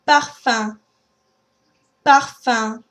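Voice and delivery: "parfum" ending in a nasal vowel, no M; French nasal um. Shown as a demonstration. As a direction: end "parfum" in the nose, don't close the lips. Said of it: "Let's practice a few words for that one".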